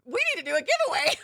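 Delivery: suddenly bursting out, fast and high; excited. Real, not acted. Host thrilled by a giveaway idea, excited burst. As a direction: blurt out the great idea.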